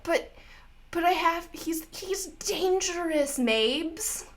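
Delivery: high, slow whining; whiny man-child. Acted. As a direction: whine like a caught kid. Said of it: "turns into a whiny man-child".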